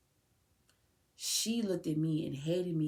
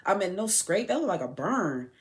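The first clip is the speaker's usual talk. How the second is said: mimicking her; 6 semitones higher, 4 dB louder than usual. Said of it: "She was like".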